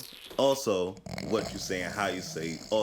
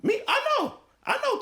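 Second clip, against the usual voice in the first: high protest; 5 dB louder than usual. Real, not acted. Cut off and protesting.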